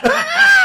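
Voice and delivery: loud, high short laugh; burst of laughter. Real, not acted. Comic about to read a crude fan message, laughing. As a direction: snort out a laugh.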